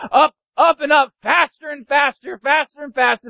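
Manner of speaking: high, urgent narration; excited climbing. A narrator's reading. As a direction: drive it on, pitch held high.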